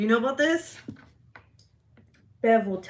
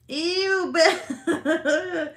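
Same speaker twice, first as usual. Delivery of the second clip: laughing ew; 10 semitones higher, 6 dB louder than usual. Real, not acted. Grossed out and laughing.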